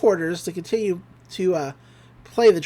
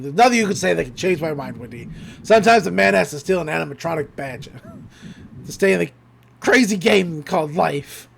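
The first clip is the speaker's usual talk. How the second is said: lightly voiced old crook, pitched down; mock-solemn, stubborn declaration in a put-on voice; Stan Pines; 3 semitones lower than usual. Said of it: "So we cut over to Stan".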